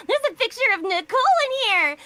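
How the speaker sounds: put-on very high, loud cat-girl squeal; Nekomata. Cat girl revealing what's inside the wallet, squealing. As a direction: squeal it out like a gleeful tattletale. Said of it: "and she goes".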